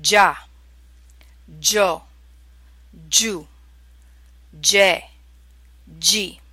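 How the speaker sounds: "Già, gio, giù, ge, gi", each starting like English J; soft Italian G. Shown as a demonstration. Teacher showing how to make the sound, soft G. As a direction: separate syllables with long pauses, soft j onset on each. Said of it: "this is how you make that sound".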